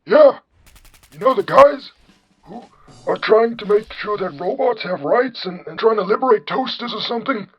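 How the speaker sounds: put-on high-pitched comic old man; John Henry. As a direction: explain in an exaggerated old-man falsetto. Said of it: "you have the generic old man voice".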